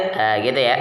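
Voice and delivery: ordinary talk, nothing marked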